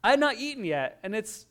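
usual speaking voice

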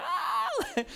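very high-pitched mock wail; imitated child scream. Five-year-old crying round the neighborhood, high mock scream. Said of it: "screaming and crying the whole way".

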